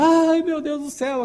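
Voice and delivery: high, drawn-out mock wail; imitated wife's melodramatic outcry; hysterical fuss. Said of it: "aquele escândalo".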